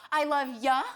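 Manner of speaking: "love ya" with a short "yuh" ending; casual "ya", vowel cut short; shortened "ya". Acted. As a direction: say "ya" instead of a full "you". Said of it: "can't you just say the whole word".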